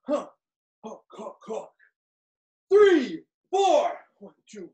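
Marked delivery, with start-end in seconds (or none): 2.7-3.9 shouted combo calls, high and loud; boxing coach calling combos. Real, not acted. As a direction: bark out the combo number.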